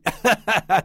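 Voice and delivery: big hearty laugh; booming giant laugh. Acted. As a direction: roar with laughter. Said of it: "Le géant éclata de rire".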